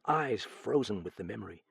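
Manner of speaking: usual speaking voice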